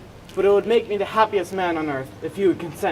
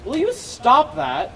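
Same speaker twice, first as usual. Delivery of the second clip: high, slow, exasperated snap; 10 semitones higher, 4 dB louder, much slower than usual. Acted. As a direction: stop him in annoyance. Exasperated at servant's noise.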